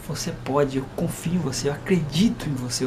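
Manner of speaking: low, soft fatherly reassurance; tender, quiet confidence; calm encouragement. Retold or imitated. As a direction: gently assure a doubting child. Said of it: "Eu falo bem assim".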